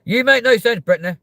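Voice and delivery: loud, high exclaim at Brittany; mock outburst. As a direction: blast it out like a diva.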